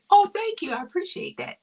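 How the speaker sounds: mock gracious thanks, pitched high; high, quick show of appreciation; polite, unfelt thanks. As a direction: thank them brightly without really meaning it. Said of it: "They don't really feel it".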